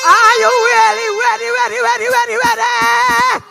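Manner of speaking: hype call. Real, not acted. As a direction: whip the crowd into a frenzy. Firing up party crowd.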